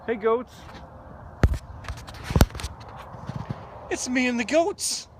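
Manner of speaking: very high, sing-song hello to animals; high-pitched greeting. Real, not acted. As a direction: greet the goats like pets.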